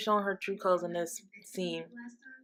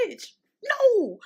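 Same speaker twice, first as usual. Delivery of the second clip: sharp high scoff at the screen, much higher and louder; incredulous bitch no; 12 semitones higher, 5 dB louder than usual. Real, not acted. Vlogger scoffing at Tasha on screen, incredulous. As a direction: shoot it down with disbelief. Reacting to Tasha's cold question.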